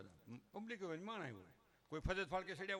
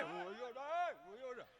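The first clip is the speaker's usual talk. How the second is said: drawn-out dismissal; 7 semitones higher, much slower than usual. Acted. Same words echoed, fading.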